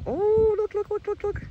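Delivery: very high, delighted squeal; rapid high-pitched urging to look; excited sighting. Real, not acted. Vlogger pointing out butterflies to viewers, excited squeal. Spots more butterflies, calls out.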